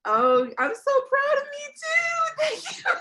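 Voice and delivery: high, loud, delighted cry; excited high shout; overjoyed squeal. Real, not acted. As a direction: squeal with pride and joy. Overjoyed host reacting to chat.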